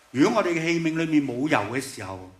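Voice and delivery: ordinary talk, nothing marked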